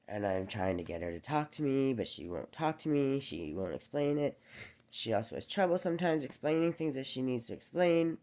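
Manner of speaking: usual speaking voice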